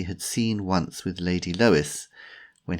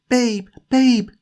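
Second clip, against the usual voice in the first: loud, high, alarmed calls; anxious calling; 9 dB louder than usual. Acted. Jemima tries to rouse injured Timothy, anxious high calling.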